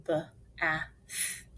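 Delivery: "B-A-Th" as three isolated sounds; short separate sounds with gaps between; sounded out. Shown as a demonstration. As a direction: sound out bath one phoneme at a time. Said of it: "Let's finger spell".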